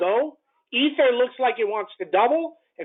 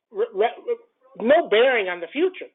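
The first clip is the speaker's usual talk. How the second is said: usual speaking voice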